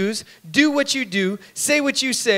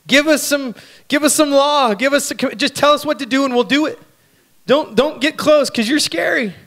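higher, louder pleading people; fearful demand; 6 semitones higher, 4 dB louder than usual. Retold or imitated. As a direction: beg for rules, scared.

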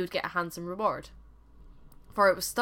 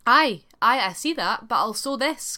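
loud, higher defiant shout back at the monster; defiant cry; 4 semitones higher, 9 dB louder than usual. A narrator's reading. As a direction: cry it out boldly, Aye aye first and loudest. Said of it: "cried the tailor".

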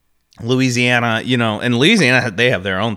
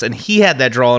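usual speaking voice

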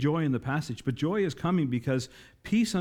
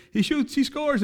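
much higher, louder game-call cry; hyped announcer call; 12 semitones higher, 5 dB louder than usual. Retold or imitated. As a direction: call it like a hockey goal. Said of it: "he'd say".

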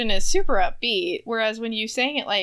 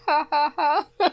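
mock laugh; 6 semitones higher, 3 dB louder than usual. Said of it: "you're like".

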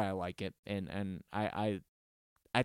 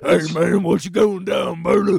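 loud, high put-on rocker voice; booming mock metal frontman talking; metal singer offstage; 5 semitones higher, 11 dB louder than usual. Imagining a metal singer offstage, loud silly stage voice. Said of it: "don't get off stage and like".

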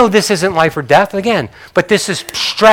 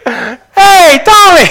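high, louder friendly call across the yard; cheerful pastor greeting; 12 semitones higher, 6 dB louder, much slower than usual. Retold or imitated. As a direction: hail him like a jolly neighbor pastor. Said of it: "A nice guy".

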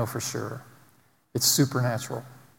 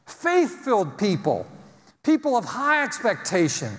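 higher, rousing preacher's cadence; full-force preaching; 7 semitones higher than usual. Acted. Pastor rousing his congregation, full-force preaching.

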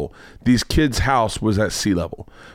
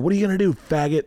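mock taunting yell; 6 semitones higher, faster than usual. Storyteller imitates kids taunting him, mock yelled jeer. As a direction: imitate kids heckling from afar. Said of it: "They keep yelling that".

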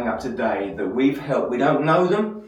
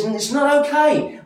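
much higher and louder, God flatly refusing; God's firm declaration; 11 semitones higher, 5 dB louder than usual. Acted. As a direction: proclaim it high and strong as God. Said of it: "He says".

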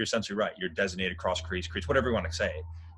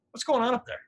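high-pitched, incredulous question; puzzled call-out; 4 dB louder than usual. Retold or imitated. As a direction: call out in disbelief, voice shooting up. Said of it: "and I'm like".

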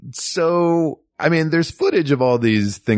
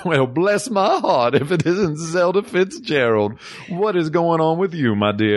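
a hammed-up deep-south Alabama lady; Southern accent; 6 semitones higher than usual. Zelig greets Zelda Fitzgerald, Southern Alabama accent. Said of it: "I'm from the deep south of Alabama".

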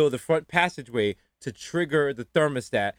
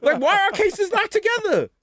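very high-pitched comic protest; hammed-up panic, voice way up; baffled outrage; 3 dB louder than usual. Protesting a stranger named as co-defendant, incredulous.

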